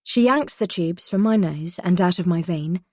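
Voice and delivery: ordinary delivery, nothing marked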